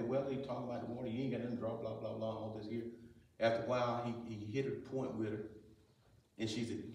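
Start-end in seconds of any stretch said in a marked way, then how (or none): 0.0-2.8 quick, soft, low run-through; dismissive recap. Retold or imitated. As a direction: rattle it off quietly like known details. Skims over earlier dialogue.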